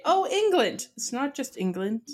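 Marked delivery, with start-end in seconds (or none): 0.0-0.9 high-pitched mimicked dismissal; mock naive remark. Host mimicking people who equate the UK with England, mock naive. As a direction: toss it out high, imitating the uninformed. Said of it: "Don't just say".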